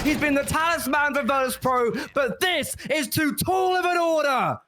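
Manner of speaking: high, shouted hype call; esports caster. Acted. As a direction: scream the clutch to the crowd. Clutch round shouted play-by-play.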